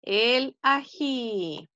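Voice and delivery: "El ají" spoken very slowly, each syllable stretched for the class; drawn-out teacher model of "El ají"; slow correct form. Shown as a demonstration. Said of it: "¿Qué digo yo?". ("El ají" muy lento y silabeado, como modelo para los niños)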